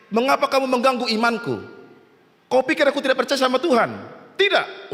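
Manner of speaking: acted protest ending on a high, curt no; stubborn refusal. Flood victim scolds his rescuers, high stubborn refusal. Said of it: "Oh, berkeras dia".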